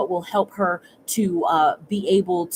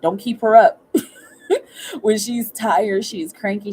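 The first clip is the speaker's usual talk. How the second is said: normal talking voice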